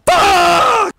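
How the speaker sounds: sudden very high, loud scream; strained scream. Real, not acted. Player failing to scream properly, strained full-force scream. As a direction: burst into a worn-voiced scream. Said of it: "I can't even scream".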